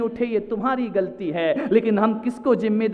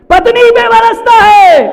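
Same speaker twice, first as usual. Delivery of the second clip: high, full-force shout; thundering answer; 13 semitones higher, 15 dB louder than usual. Real, not acted. Preacher revealing whom the husband rages at, shouted. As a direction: blast out the answer.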